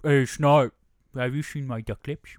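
pouty duck-lipped voice; duck lips mimicking. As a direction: talk through pursed duck lips. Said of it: "She's like".

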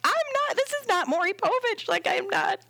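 very high-pitched retold panic; squeaky mock alarm, pushing the idea away; overwhelmed protest. Doula with everyone staring, high-pitched overwhelmed protest.

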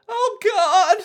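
very high, loud cartoon wail; Jacuzzi. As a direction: exclaim it in a squeaky falsetto. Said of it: "says Jacuzzi in his normal".

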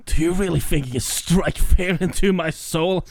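rising to a loud shout; taunting, then yelling it out; mock villain roar building; read as villain. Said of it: "should I read it".

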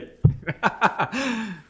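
loud high cackling laugh; real laughter erupting at the joke; big burst of laughter. Real, not acted. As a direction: crack up at the punchline. Laughs at friend's crude punchline.

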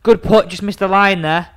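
loud, high exaggerated "oh"; drawn-out mocking commiseration; nasty mock praise. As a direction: needle a rival with fake sympathy. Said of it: "I'll say horrible, nasty things".

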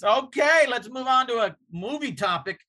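a light impression of a host breezily changing topic; brushing it off. The host skipping past the tension, in a lightly voiced brush-off. Imitating Doug changing the subject.

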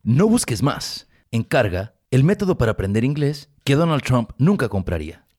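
slow, loud commercial announcer; mock ad read. Host reading the fake sponsor ad, booming. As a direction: sell it big like an infomercial.

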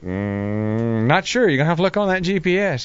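high, quick mock-innocent dodge; evasive brush-off. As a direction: play coy and brush it off. Evasive reply to marshal.

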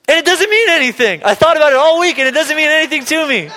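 high-pitched cashier laughing it off; cashier, deflating. Retold or imitated. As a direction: blurt it out cheerfully, missing the point. Cashier says it means nothing.

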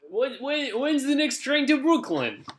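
very high, loud caricature New York accent; New Yorker accent. Shown as a demonstration. Brit improvising for friends, hammed-up New York accent. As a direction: squawk it as a cartoon New Yorker. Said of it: "What about a New Yorker?".

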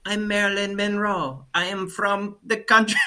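a high, hammed-up Cuban-accented Marilyn line; thick Spanish accent on an American icon; mock Cuban accent. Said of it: "she can't get rid of her accent".

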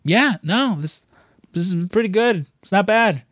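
grudging approval of the food; mock Ramsay tasting and liking it; chef pleasantly surprised. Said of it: "he's like".

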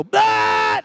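sudden high loud "Bah!"; duck shout. Shown as a demonstration. Pastor showing the crowd the duck, loud high shout. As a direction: startle them with a loud high bark. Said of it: "like that".

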